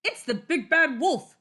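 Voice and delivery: high, loud panicked cry; alarmed cry. Acted. Missus Goose cries out in fright, high and loud. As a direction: shriek the warning. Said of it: "cried Missus Goose".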